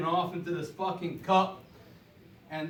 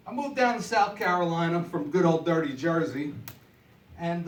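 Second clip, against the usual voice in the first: ordinary delivery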